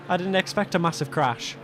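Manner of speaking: ordinary talk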